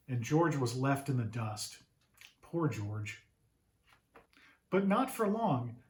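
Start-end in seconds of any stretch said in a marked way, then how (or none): 2.4-3.2 low, soft and sorry for him; pitying sigh. A narrator's reading. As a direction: say it like you feel bad for the dog. Sympathetic narrator aside to children.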